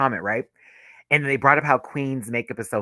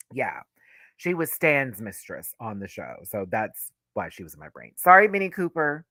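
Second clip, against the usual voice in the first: ordinary talk, nothing marked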